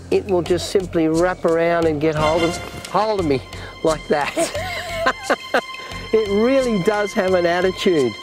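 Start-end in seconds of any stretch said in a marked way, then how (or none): 4.4-6.1 amused laugh. Real, not acted. Laughs at centipede attack.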